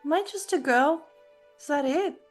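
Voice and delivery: usual speaking voice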